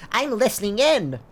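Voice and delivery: high-pitched sneaky little character; comic high eavesdropper voice; suspicious eavesdropper. Retold or imitated. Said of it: "suspicious Lalafell on the right that's just like".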